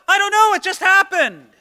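put-on the caught kid who has no idea; mock teenager. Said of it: "Anybody who's ever had a teenager".

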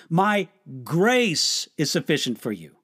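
"My" leaned on first; stress on My. Shown as a demonstration. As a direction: press on "My", the rest falls away.

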